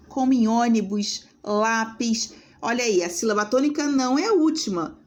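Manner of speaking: ordinary talk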